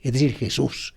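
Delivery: ordinary talk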